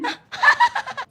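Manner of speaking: high teasing cackle; mocking laugh. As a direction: laugh at her, high and bright. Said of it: "Kok ketawa?". (tawa mengejek yang melengking)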